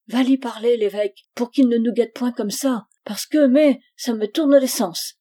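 upset wife pleading loudly; agitated plea. Acted. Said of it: "La mère eut une émotion, et toute pâle".

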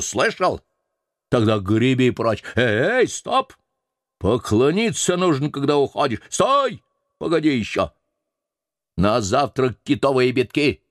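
high, commanding mate voice; bossy sailor. Acted. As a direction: lord it over the old cook. Stubb calls the cook back.

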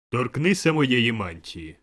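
slow, loud spirit voice; booming command. A narrator's reading. The spirit telling Scrooge to touch its robe, slow booming command. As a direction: order it grandly at full volume.

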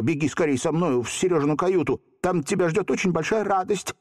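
put-on high, eager boy urging; agitated babble. A narrator's reading. Volka calling Hottabych to the cabin, agitated. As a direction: coax him to hurry. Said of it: "взволнованно залепетал Волька".

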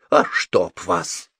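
slow, restrained curse; through clenched teeth. A narrator's reading. Cornered wizard curses at Sophie, teeth clenched. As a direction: squeeze out the curse with held-in annoyance. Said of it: "процедил Холл сквозь зубы".

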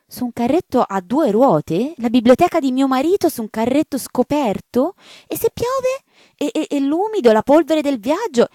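an aristocrat appalled for her husband's books; an old lady rising to outrage; indignant marchioness. Acted. Marchioness horrified.

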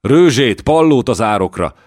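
high, loud commands; shouted orders. Acted. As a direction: bark orders across the siege. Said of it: "kiáltotta a szűz".

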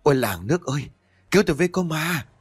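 terrified scream for help. Acted. Old cook shrieking for help at the ghost child, frightened scream. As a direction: cry out for the village to save you from a ghost. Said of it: "rồi thét lên thất thanh".

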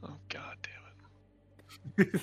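slow unvoiced whisper; exasperated whisper. Real, not acted. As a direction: breathe it out, defeated.

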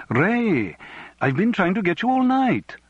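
put-on high, sing-song Welsh accent; lilting Welsh accent. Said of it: "a lilting Welsh voice said".

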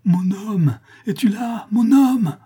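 high, loud calls; desperate crying out. A narrator's reading. La Louve circling the house for her mate, high desperate cries. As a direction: shout for your man like a searching beast. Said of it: "elle criait".

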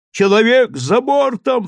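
put-on high, loud alarm from the old man; cry out. A narrator's reading. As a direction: yell the man-overboard alarm. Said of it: "вскрикнул старик".

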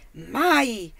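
a loud, terrible cry of refusal; shouted refusal. A narrator's reading. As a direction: bark it out as a flat, final no. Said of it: "gridò con una voce terribile". (grido forte e terribile)

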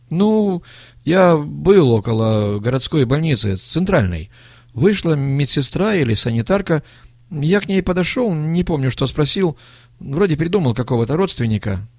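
put-on evasive young man. A narrator's reading. Young man confessing to the old man, uneasy.

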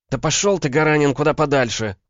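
high, loud female fury; spat out. A narrator's reading. Woman telling her lover to get lost, spitting angrily. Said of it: "выплюнула она".

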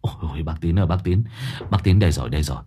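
nervous stammering plea; flustered. Acted. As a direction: stumble over words in a rush.

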